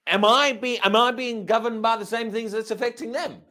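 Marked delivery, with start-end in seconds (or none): none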